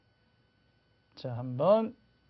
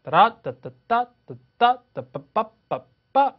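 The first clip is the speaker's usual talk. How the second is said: higher, louder, slow da-da syllables tapping the beat; rhythm scat; 6 semitones higher, 5 dB louder, much slower than usual. Shown as a demonstration. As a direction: sing out the stress rhythm on nonsense syllables. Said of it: "뭐 이렇게 하죠". (높고 크게 따라따따 리듬 흉내)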